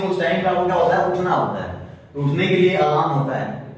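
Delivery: usual speaking voice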